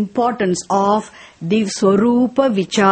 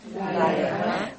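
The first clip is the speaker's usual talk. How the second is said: students' soft repeat; 4 semitones lower, 9 dB quieter than usual. Real, not acted. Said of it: "Say it now".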